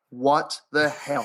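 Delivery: slow, low stunned disbelief; spaced-out, low-pitched incredulity; retold disbelief. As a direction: draw each word out in mock amazement. Retold stunned reaction to deal.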